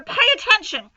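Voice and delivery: high, loud command; yelled order. Acted. Wilbur yelling at Templeton, high and loud. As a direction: yell the rat to attention.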